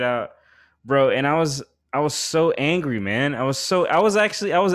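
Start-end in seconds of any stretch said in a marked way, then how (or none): none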